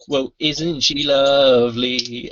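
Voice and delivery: slow, overdone sweetness to disarm a heckler; mock sweet reply. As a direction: turn the insult round with exaggerated charm. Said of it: "and he goes".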